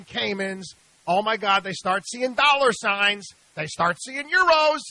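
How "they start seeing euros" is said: high, loud, dreamy greed at euros; greedy excitement. Retold or imitated. Radio host mocking investors dazzled by euros, giddy. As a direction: act out a mark seeing euros, voice jumping up.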